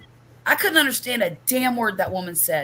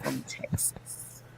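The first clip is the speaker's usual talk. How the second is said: slow hushed whisper aside; 7 semitones lower, slower than usual. Acted. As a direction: let it slip as a secret, slow.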